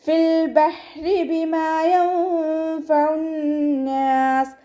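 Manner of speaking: slow, high melodic tajweed chant; chanted Quranic verse, drawn out; Quran recitation. Shown as a demonstration. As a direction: stretch each syllable in a ringing recitation.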